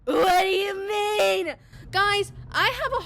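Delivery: high, slow, loud wailing cry; mock panic. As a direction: wail it in horrified disbelief. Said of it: "And I was like".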